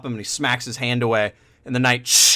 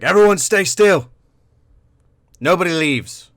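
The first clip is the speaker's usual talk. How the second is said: stern command; 5 semitones higher, 4 dB louder than usual. Acted. Knight halts the tavern.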